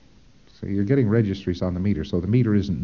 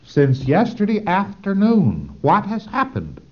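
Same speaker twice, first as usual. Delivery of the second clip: slow, higher, louder questioning; probing auditor; 10 semitones higher, 6 dB louder, slower than usual. Shown as a demonstration. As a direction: press the PC gently but firmly for an answer. Auditor demo asking the PC.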